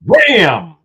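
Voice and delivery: loud triumphant bam; excited. Real, not acted. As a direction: shout it out with glee. Reveal exclamation.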